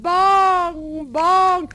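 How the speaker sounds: loud ringing bell sounds, bong bong; booming bell imitation; church bell bongs.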